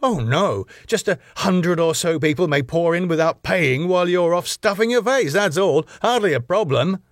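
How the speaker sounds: high, swooping mock reassurance; sarcastic. A narrator's reading. Husband mocks wife for leaving the door, sarcastic. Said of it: "Max, sarcastically".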